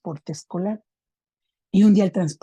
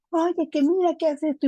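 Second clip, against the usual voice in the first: lightly voiced mother, pitched higher; imitating a woman calling her daughter in a higher voice; voz de la señora; 9 semitones higher than usual. (imitando a una madre, voz aguda)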